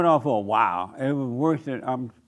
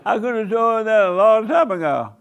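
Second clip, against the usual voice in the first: amused outburst; 8 semitones higher, 7 dB louder than usual. Real, not acted. Laughing aside after stalker story.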